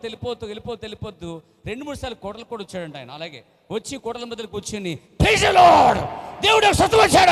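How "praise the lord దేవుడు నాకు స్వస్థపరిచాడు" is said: high, loud shout of praise from the crowd's middle; joyful shout. Retold or imitated. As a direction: cry it out at the top of the voice, overjoyed. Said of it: "అరిచింది ఎక్కువ ఆయన". (ఆనందంతో గట్టిగా అరుపు)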